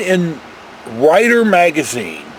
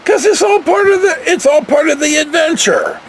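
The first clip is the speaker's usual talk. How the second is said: emphatic answer; 10 semitones higher than usual. Pretend. Answering an imagined viewer, high and emphatic. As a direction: sell it like the ride was worth every mile. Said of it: "I'm gonna say".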